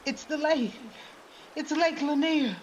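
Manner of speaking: high-pitched alarm; panicked exclamation. A narrator's reading. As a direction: cry out as disaster hits. Panicked exchange as the house sinks.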